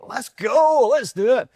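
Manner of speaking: jittery high excited burst; buzzing from too much coffee; hyped up. Retold or imitated. To the interviewer, mimicking his caffeine rush, excited. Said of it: "I've had too much of that coffee".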